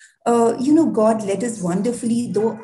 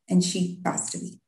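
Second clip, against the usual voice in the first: hushed grief; 3 semitones lower, 7 dB quieter than usual. Real, not acted. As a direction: drop to a breathy near-whisper, much softer. Telling of her sister's death.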